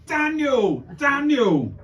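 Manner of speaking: high, loud call across a station; insistent high-pitched shout for someone. Retold or imitated. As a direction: yell the name, pitch falling away at the end. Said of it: "he's just stood there shouting".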